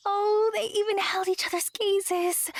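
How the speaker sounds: whispered then squealing high delight; gushing narrator. A narrator's reading. Narrator cooing over the couple's shared gaze. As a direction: coo over the lovers.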